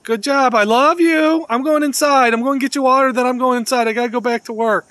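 high, loud, loving pet-talk; affectionate goodbye call. Real, not acted. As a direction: call a fond farewell to an animal at a distance. Calling goodbye to the squirrel.

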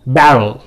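"बैरल" with the T softened to a D; American flapped T. Shown as a demonstration. Teacher demonstrates American T as D to class. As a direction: say it like barrel, T flapped to D. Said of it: "डी मैं बोल रहा हूँ".

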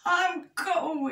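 high-pitched miserable complaint; hammed-up whingeing bear cub; grouching. A narrator's reading. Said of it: "he grouched".

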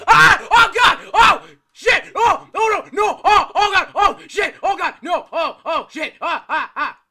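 very high, very loud shrieks; terrified screaming. Real, not acted. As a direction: scream for your life. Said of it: "That actually scared me!".

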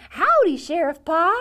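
loud, high jump-out greeting; child leaping out to surprise; bursting howdy. Acted. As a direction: pop out with a cheer. Cowboy Baby jumps out.

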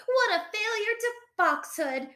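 high-pitched indignant cry. A narrator's reading. Four foxes condemning Fosdyke, crying out. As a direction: exclaim it high, in mock outrage. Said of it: "the ferocious four cried".

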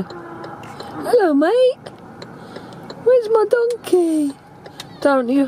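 high, squeaky playful voice; silly high voice. Pretend. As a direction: put on a goofy high voice for the donkey. Talking to donkey and Bedouin boy.

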